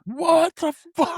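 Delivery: high, drawn-out stunned cry; very high slow stunned yelp; shocked mock squeal. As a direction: squeak out your disbelief slowly. Reacting to the twist.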